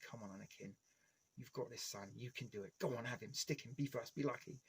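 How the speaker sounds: soft, intense coaching under the breath; hushed cheering on. Retold or imitated. Fan quietly cheering Anakin on during the duel, hushed. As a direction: urge him on quietly like a nervous fan. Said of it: "I'm like".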